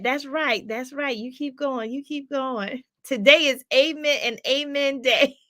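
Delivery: ordinary delivery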